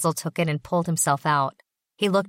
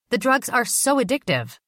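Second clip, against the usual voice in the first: irritated; 8 semitones higher than usual. A narrator's reading. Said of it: "obviously irritated".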